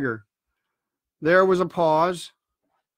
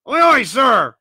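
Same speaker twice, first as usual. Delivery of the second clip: loud, higher crew reply; shouted answer; 6 semitones higher, 7 dB louder than usual. A narrator's reading. Crewmen answer their blind leader, calling out loudly. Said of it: "answered the two or three".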